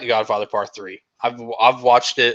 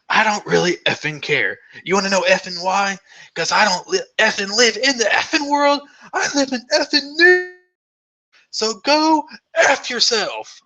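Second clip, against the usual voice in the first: angry rant, much higher, building to a strained peak; Michael Keaton; 9 semitones higher than usual. Retold or imitated. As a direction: rant indignantly, climbing higher with every line, slowing on the last. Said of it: "rant that he does".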